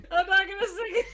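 high squeak through a laugh. Real, not acted. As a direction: break up laughing as you refuse.

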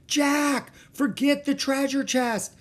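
very high, drawn-out shout; urgent cry. A narrator's reading. Girl to dawdling brother from treehouse, crying out. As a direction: shout impatiently to break his trance. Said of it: "cried Annie".

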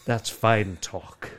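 very slow, drawn-out cowboy reply; lightly mimicked Texan, slow and flat; slow Texan drawl. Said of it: "And I'll say".

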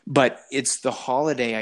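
usual speaking voice

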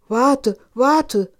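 loud, high shout from the frog; calling after. A narrator's reading. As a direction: shout for her to stop as she runs off. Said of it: "rief der Frosch". (laut hinterherrufend)

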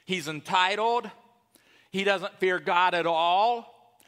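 usual speaking voice

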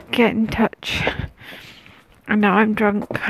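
normal talking voice

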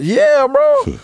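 real high-pitched exasperation; worked-up agreement. Real, not acted. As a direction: agree in a high, indignant cry. Agreeing heatedly about being spat on.

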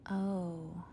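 soft, small "oh"; subdued realization after an outburst; deflated. Acted. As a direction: let it out quietly, crestfallen. Panda regrets scaring friends away.

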